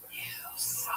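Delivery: soft, voiceless whisper; secretive mock heckle, all breath; imitated heckler whisper. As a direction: whisper it privately from the front row. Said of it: "guy in the front row just whispering".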